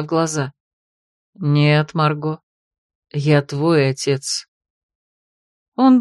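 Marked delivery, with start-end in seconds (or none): 1.4-4.5 low, slow fatherly voice. A narrator's reading. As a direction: speak as a gentle father.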